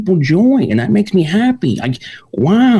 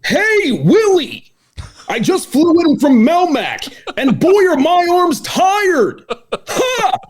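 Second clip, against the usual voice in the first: high, slow Alf voice with a shout; Alf impression; 11 semitones higher, much slower than usual. For his co-host, a high slow Alf impression. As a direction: ham up the alien Alf. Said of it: "the Alf".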